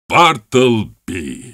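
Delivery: Bartleby roared out, slow and drawn long. A narrator's reading. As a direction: let the name boom slowly across the room. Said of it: "diye kükredim".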